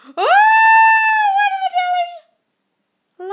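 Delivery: a high, loud, drawn-out shriek of delight; excited squeal. Real, not acted. Reading a viewer's good news, she shrieks with delight. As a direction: squeal it out, thrilled.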